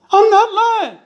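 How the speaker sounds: high, loud insistent yell; shouted denial. A narrator's reading. Husband denies lying to his wife, shouting.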